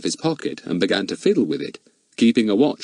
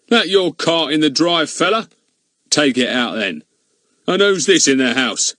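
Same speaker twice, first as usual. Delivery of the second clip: loud, high, bossy constable; pompous village bobby; bossy policeman; 7 semitones higher, 4 dB louder than usual. A narrator's reading. Goon questioning Fatty.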